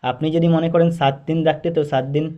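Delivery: ordinary talk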